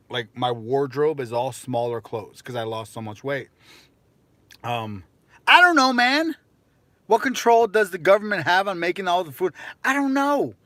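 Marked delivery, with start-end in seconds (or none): 5.5-10.6 high, loud exasperated rant; exasperated outburst. Real, not acted. Streamer snaps back at chat's question, high and loud. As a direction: throw up your hands at the chat.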